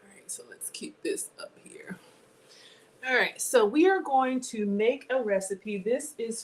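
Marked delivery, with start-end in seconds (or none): 0.0-2.0 whispering. Real, not acted. Quiet aside to self.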